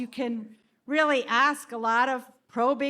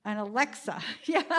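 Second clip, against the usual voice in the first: ordinary talk, nothing marked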